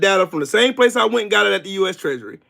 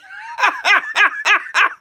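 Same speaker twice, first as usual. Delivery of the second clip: high cackle in quick bursts; mocking laugh; 13 semitones higher than usual. Real, not acted. Streamer laughing after calling Tito dickhead, high mocking cackle. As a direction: cackle at his loss.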